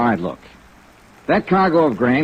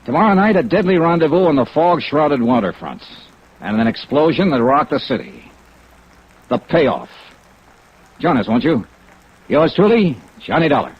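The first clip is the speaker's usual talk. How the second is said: punchy teaser read, opening well up and louder; radio preview announcement; 2 semitones higher, 3 dB louder than usual. Acted. Star teases the next episode to radio listeners, punchy announcer read. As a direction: sell tomorrow's thrills to the listeners.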